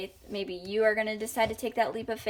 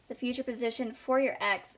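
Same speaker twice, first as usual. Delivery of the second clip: ordinary talk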